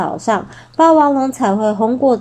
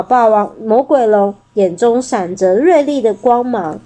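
ordinary delivery, nothing marked

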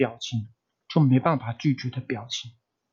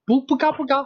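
much higher, coy voice acting out the pitch; performed on request; 11 semitones higher than usual. Host asks him to act it out with his voice, high and coy. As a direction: squeeze the odds out in a high coy voice. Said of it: "你现在用声音就表一次".

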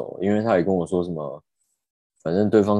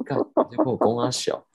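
high-pitched, exasperated retort, retold; retold annoyance; 7 semitones higher than usual. Driver fed up with a police officer's evasions, retold annoyance. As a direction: replay your annoyance with pitch jumping up. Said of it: "当时就很不爽啊".

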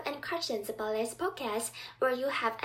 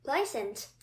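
usual speaking voice

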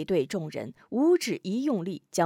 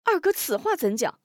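higher, puzzled question; stunned by the rebuke; startled asking; taken aback; 8 semitones higher than usual. Acted. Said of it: "吴中一怔".

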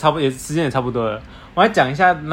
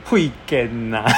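put-on southern Taiwanese accent; 5 semitones lower, much slower than usual. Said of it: "都同样身为南部人".

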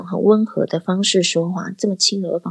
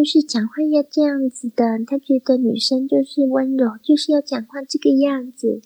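lightly put-on, higher, dainty feminine speech; soft girly voice; 6 semitones higher than usual. Shown as a demonstration. Demonstrating the classmate's idea of gentleness, lightly voiced soft girly tone.

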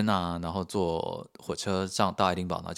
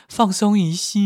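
playful high flourish; 12 semitones higher, 10 dB louder, slower than usual. Pretend. Advice ends in a flourish.

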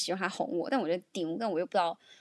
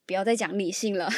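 ordinary delivery

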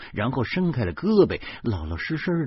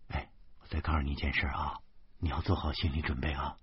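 soft, low and confiding; careful warning; 6 semitones lower, 8 dB quieter, faster than usual. A narrator's reading. Wife preparing her husband for news, low and careful. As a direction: break it to them quietly.

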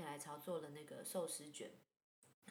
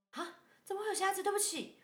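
startled apology; 14 semitones higher, 8 dB louder than usual. Retold or imitated. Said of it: "然后我说".

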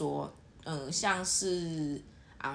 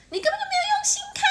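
much higher, louder, fast scolding yell; angry shout; 6 dB louder, faster than usual. Retold or imitated. Girlfriend yelling at boyfriend for not looking carefully. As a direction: blast him for not paying attention. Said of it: "有的女生会觉得".

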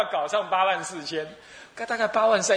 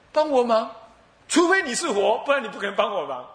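scoffing; 5 semitones higher than usual. Acted. Old man scoffs at offer.

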